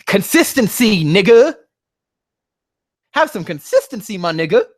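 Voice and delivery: high, loud outcry demanding consistency; exasperated shout. Real, not acted. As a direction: shout it out at the writer. Yelling at the fanfic's inconsistency.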